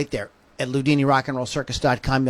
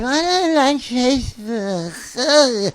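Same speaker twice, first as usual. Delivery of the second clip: mocking whine; 8 semitones higher, much slower than usual. Mocking a grumbling listener.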